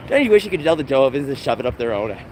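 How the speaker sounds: ordinary talk, nothing marked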